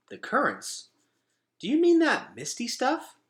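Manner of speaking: high, loud, worried questions; a frightened boy pressing for answers; anxious boy. A narrator's reading. Gregor anxiously questioning Vickis about the currents. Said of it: "asked Gregor".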